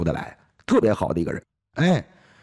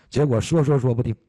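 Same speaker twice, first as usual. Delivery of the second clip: ordinary talk, nothing marked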